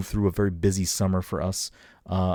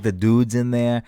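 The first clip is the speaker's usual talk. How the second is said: Boston accent demo; 5 semitones higher, 5 dB louder than usual. Shown as a demonstration. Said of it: "You gotta throw, like".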